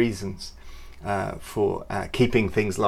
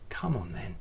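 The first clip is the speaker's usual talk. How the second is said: very soft, tender reassurance; quiet, loving reassurance; gentle; 8 dB quieter than usual. Pretend. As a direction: soothe a doubter. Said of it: "he's always like".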